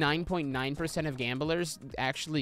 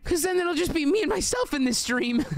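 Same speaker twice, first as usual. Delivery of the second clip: mock panicked protest; 12 semitones higher, 3 dB louder than usual. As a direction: plead in a much higher voice, playing it up. Mock protest at being made to leave.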